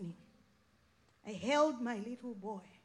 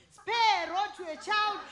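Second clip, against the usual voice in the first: emphatic quote; 6 semitones higher, 10 dB louder than usual. Retold or imitated. Quoting the mother's scripture.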